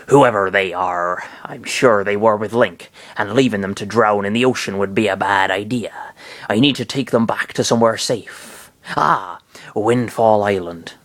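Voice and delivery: a hint of pirate, lightly voiced; rough pirate talk, half-hearted. Pirate captain finding the drifting trio, a hint of pirate accent. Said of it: "let's make him, uh, a pirate".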